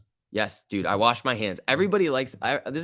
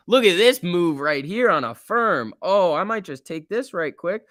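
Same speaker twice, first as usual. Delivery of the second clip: higher and louder eager chatter; real excitement at the chart; excited; 7 semitones higher, 5 dB louder than usual. Real, not acted. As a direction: keep the thrill going. Still excited about move.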